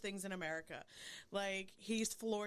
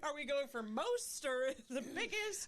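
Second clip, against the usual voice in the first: mock eager excitement; 6 semitones higher, slower than usual. Acting eager hunter from duet.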